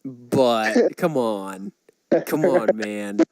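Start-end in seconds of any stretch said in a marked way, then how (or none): none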